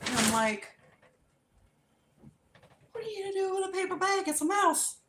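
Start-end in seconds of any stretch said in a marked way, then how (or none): 2.9-5.0 high, rapid squeak; reenacted incredulous whine; panicked disbelief. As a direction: question mom in a rushed, alarmed voice. Baffled panicked question to mom.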